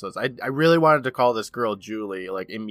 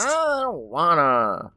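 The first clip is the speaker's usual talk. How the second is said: drawn-out, higher mock whine; whiny refusal; 6 semitones higher, 4 dB louder, much slower than usual. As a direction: drag it out like a sulky kid refusing. Mock childish refusal to watch.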